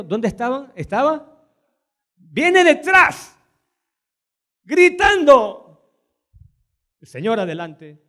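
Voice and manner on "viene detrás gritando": high, loud, very slow cry; very slow high impassioned preaching; preaching climax. Acted. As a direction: lift it up and stretch it out.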